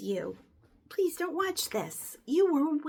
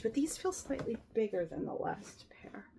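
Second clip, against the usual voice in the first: whisper; 4 semitones lower, 9 dB quieter than usual. Real, not acted. Aside while sorting leggings.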